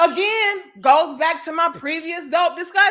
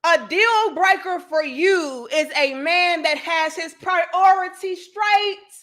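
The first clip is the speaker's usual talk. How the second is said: ordinary talk, nothing marked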